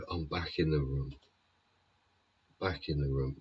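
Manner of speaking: normal talking voice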